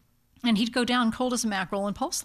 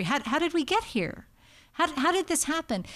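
repeated halting questions, pitched up; bewildered; 5 semitones higher than usual. Acted. Family after dad's hip surgery, mildly bewildered. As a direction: sound mildly at a loss, restarting each question. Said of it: "helps calm this bewilderment".

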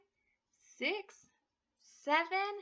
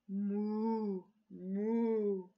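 cow moo; 3 semitones lower, 4 dB louder than usual. Acted. Cow sound in story.

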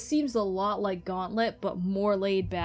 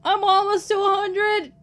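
high, loud excited call; excited shout; 10 semitones higher, 6 dB louder than usual. Real, not acted. Player excitedly shouting about progress. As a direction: cheer your own progress.

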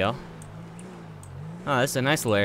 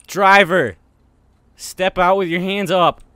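loud, higher, slow bark of an order; shouted police command; 5 semitones higher, 10 dB louder, slower than usual. Pretend. Cop ordering the driver out at gunpoint, shouted command. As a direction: shout it across to the car.

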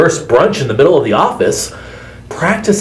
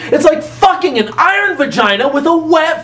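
excited rant; 11 semitones higher than usual. Acted. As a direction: rant it out, worked up. Ranting advice, shouting laughs.

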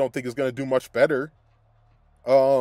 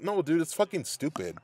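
ordinary talk, nothing marked

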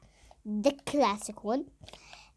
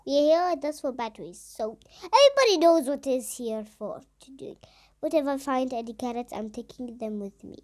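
ordinary delivery

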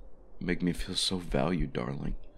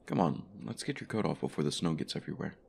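ordinary talk, nothing marked

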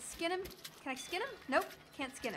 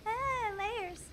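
mock shower scene; 6 semitones higher, 3 dB quieter, much slower than usual. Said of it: "Shower scene".

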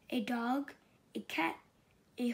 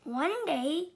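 ordinary delivery

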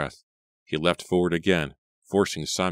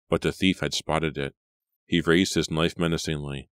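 plain reading voice

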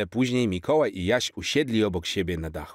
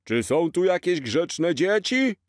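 slow, higher put-on Santa voice; jolly Santa; 10 semitones higher, 4 dB louder, slower than usual. Dad in a Santa suit asking for good children, slow and jolly. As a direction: play Santa for the kids, drawn out.

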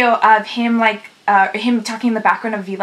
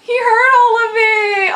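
overwhelmed; 11 semitones higher, 5 dB louder than usual. Real, not acted. Overwhelmed by the news.

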